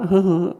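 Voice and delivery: a quick, high laugh; short laugh. Real, not acted. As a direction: let out a little laugh. Laughing while telling the story.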